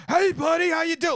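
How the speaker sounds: mock Gino charming the staff; schmoozing comic. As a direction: greet the waiter like an overeager showman. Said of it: "He would just go down and be like".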